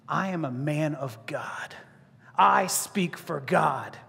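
slow, low, portentous proclamation; solemn man of God. Acted. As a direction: claim divine authority slowly and gravely. John hypothetically claims authority.

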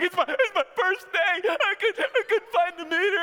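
a scared meter reader stammering out an explanation; nervous panicked pleading. Retold or imitated. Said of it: "He's like".

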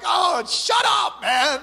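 high, slow, laughing shout; exasperated retort. Retold or imitated. Seasick young man telling the crying cook to stop, laughing shout. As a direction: snap back at him, half laughing. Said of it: "I'm like".

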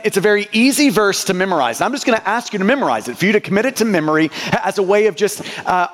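ordinary delivery

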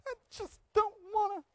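put-on high, soft, whining child voice; mock whiny child refusing; whiny kid. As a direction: whine it like a sulky kid at bedtime. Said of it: "and they go".

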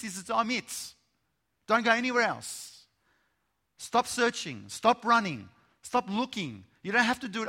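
loud, high, driving sermon voice; preaching at full force. Acted. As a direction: proclaim it, building the fire.

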